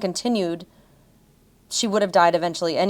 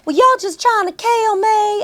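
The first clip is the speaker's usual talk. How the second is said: much higher, louder, slow Southern belle whine; mock Southern belle; 14 semitones higher, 6 dB louder, slower than usual. Podcaster imitates the actress as a high, slow Southern belle. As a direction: ham up a drunk Southern belle. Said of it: "talking like a Southern belle".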